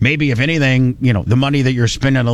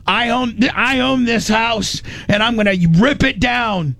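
hammed-up drunk husband snarling at his wife; angry husband; 9 semitones higher than usual. Husband threatens his wife over the house, mock angry and high. Husband voiced in retold fight.

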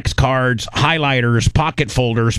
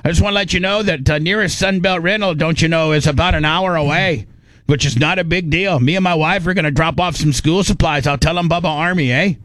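a light Canadian lilt, a bit higher; Canadian accent; 4 semitones higher than usual. Retold or imitated. Caller's email read in a Canadian accent. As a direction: play the friendly Canadian listener, eh at the end. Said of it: "the guy was like".

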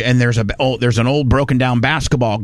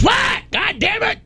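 shouted reply; slower than usual. Retold or imitated. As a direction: bark it back across the yard. Doug yells back unseen.

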